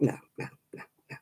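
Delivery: soft whispered refusal, almost voiceless. Retold or imitated. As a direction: hush it, shaking off the idea. Retold refusal to ask strangers.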